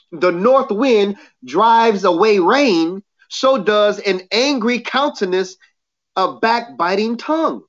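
slowed down, each phrase weighted. Shown as a demonstration. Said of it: "I'll say it again".

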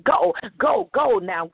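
high, forceful go, go; barked order. Real, not acted. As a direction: shout the command out. Commanding dullness to leave.

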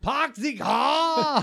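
put-on slow, drawn-out Boston accent. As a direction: stretch it out in a thick Boston accent. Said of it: "How would you do a German Boston accent?".

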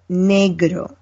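Negro said NEH-gro with open e and tapped r; Spanish pronunciation. Shown as a demonstration. Teaching the hosts Spanish, modeling Negro in Spanish. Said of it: "a Spanish lesson".

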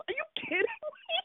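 can you believe this, pitched sky high; real exasperation at Eric; exasperated disbelief. Real, not acted. Exasperated at the storyline.

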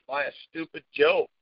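usual speaking voice

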